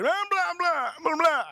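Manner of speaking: high-pitched imitating gibberish, no real words; mock foreign babbling. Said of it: "That's all I heard".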